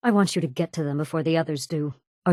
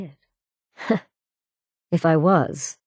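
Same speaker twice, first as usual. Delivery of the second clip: a short mocking laugh into the words; dismissive chuckle; scoffing laugh; much slower than usual. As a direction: laugh it off with contempt. Scoffing at an accusation.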